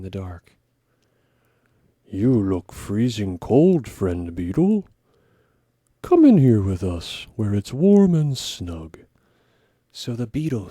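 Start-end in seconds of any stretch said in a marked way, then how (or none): 2.0-9.0 louder bear cub voice, inviting. Acted. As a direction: call out big and friendly like a bear cub.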